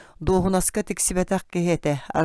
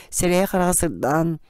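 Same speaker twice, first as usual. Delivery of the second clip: ordinary delivery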